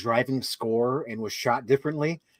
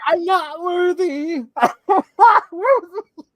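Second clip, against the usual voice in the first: high, slow, wailing self-pity; hammed-up sobbing that cracks into laughter; mock weeping; 7 dB louder, much slower than usual. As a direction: imitate a drawn-out tearful wail. Imitating character's tearful self-pity.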